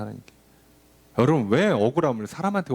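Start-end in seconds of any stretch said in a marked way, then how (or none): 0.0-0.3 하나님께 diulang pelan dan jauh lebih lirih; soft echo. Shown as a demonstration. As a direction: ulangi 하나님께 hampir berbisik, sangat pelan. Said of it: "하나님께 얘기하는 겁니다".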